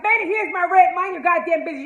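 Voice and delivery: high, loud telling-off; loud scolding. Real, not acted. Telling Benny to mind his business in a loud, high scold. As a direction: snap at Benny to butt out.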